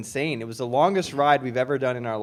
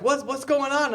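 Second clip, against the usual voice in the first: alarmed questioning; 10 semitones higher than usual. Retold or imitated. Friends react to the yell.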